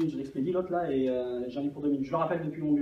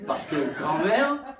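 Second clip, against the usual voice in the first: high, slow, laughing ad slogan; sing-song like a coffee ad; mock advert voice; 4 semitones higher, 5 dB louder, much slower than usual. Boss quoting a coffee ad slogan, slow and laughing.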